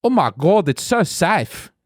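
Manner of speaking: put-on slow amazed South African accent; South African impression. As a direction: marvel slowly at the safety, South African style. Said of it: "my impression of a South African".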